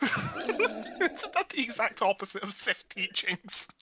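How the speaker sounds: high, loud, slow disbelief; incredulous. Real, not acted. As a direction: ask in total disbelief. Incredulous question to Luke player.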